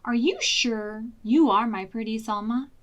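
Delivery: ordinary delivery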